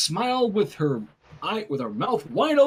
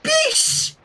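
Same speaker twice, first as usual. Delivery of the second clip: put-on very high, tiny baby squeak; 4 dB louder than usual. Baby Flurry blurting a swear at Rainbow, very high. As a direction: squeak it like a toddler's first word. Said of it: "Flurry said it for her".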